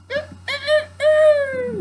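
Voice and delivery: very high, loud ooh crow; full-force rooster call. A narrator's reading. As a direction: crow with all your might. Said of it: "crowed ... with all his might".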